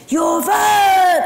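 high, loud shout across the water; excited yelled news; retold shout. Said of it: "Howard was one of the boats going".

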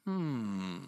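low, soft, drawn-out hum; quiet thoughtful "hmm"; pondering. Acted. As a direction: hum as if mulling it over. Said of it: "A pondering face".

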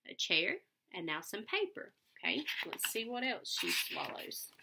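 plain reading voice

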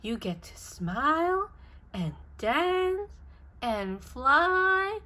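usual speaking voice

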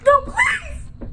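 high, loud laughing shriek; panicked scream. Real, not acted. Gamer shrieking as the monster lunges.

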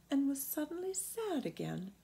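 plain reading voice

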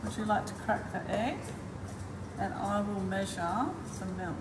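usual speaking voice

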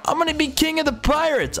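Luffy impression. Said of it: "that's Luffy".

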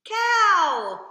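put-on loud sung call to kids. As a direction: belt the answer out. Children's singalong, answer called out.

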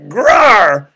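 loud, high mock snarl; demonstrated growl. Shown as a demonstration. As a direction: let out a sudden groaning roar. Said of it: "It just says that Jesus".

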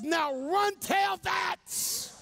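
slow, high mocking taunt to the fleeing men; devil's taunt. Demon taunting the beaten men as they run, slow high gloat. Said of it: "the devil says".

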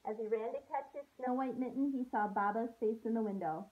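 storybook read-aloud voice for a class; gentle story narration to kids; reading to children. A narrator's reading. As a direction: read it like a teacher sharing a picture book. Teacher reads picture book aloud.

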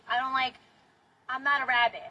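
usual speaking voice

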